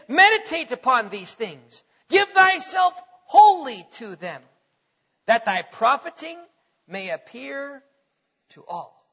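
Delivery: slow, loud, swelling then dropping away; preaching scripture to the congregation, slow and fervent; slow scripture reading. Acted. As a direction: lean hard on each phrase, then let it fall.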